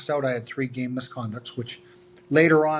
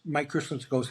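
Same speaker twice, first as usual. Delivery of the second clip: usual speaking voice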